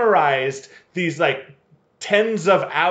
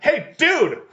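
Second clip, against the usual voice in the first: high, punched interjection; mock attention-getting call; 11 semitones higher than usual. Shown as a demonstration. Co-host showing how Al should interrupt, sharp call. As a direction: cut in with a raised pitch.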